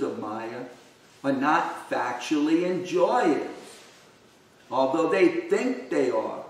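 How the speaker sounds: measured, emphatic read-aloud; scripture reading aloud. Acted. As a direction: read the text out in deliberate phrases, leaning on key words. Reads purport aloud from a book.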